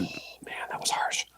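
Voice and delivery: breathless whispered remark; whispered aside. Real, not acted. Reacting to the sleepless night, whispered aside. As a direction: breathe it out under the voice.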